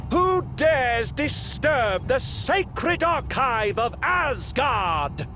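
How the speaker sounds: slow, high, booming outrage; outraged royal challenge. Acted. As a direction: thunder it at intruders, drawing out the words. King confronts archive intruders.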